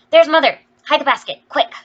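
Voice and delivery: high, loud warning to her sisters; urgent cry. A narrator's reading. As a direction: cry out in a rush to hide it. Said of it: "cried Jo".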